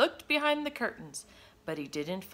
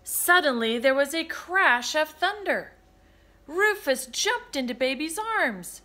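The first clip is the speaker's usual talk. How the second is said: usual speaking voice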